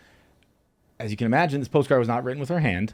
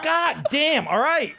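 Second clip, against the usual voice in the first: high yelp of pain, half laughing; startled pained cry; 14 semitones higher, slower than usual. Retold or imitated. Spanked comic yelling in pain at the strip club. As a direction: cry out as the belt lands high. Yelps at second whip.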